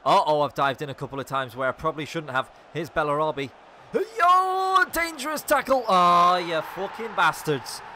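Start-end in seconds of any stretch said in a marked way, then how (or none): 3.9-7.8 high yelp sliding into a loud slow curse; alarmed cursing. Real, not acted. Gamer seeing the rival counter, loud alarmed curse. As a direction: yelp then swear at the screen.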